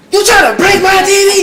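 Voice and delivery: high, loud mock yell; hammed-up accusing yell; imitating her shout. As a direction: shriek it the way she did. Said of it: "She should have been like".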